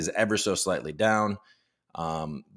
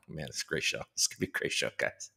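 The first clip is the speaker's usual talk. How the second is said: soft whisper to the chat; whispered aside; 7 semitones lower, 9 dB quieter than usual. Real, not acted. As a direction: whisper it like a secret.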